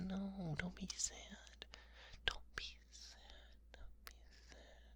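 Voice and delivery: ordinary delivery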